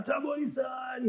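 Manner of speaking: imitated screaming fans. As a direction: holler the star's name like a distant fan. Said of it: "construction workers were screaming down".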